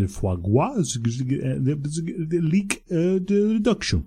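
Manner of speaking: faltering, slow fake French accent; mock French accent. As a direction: mumble the dish like a French waiter, French accent. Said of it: "through their French accent".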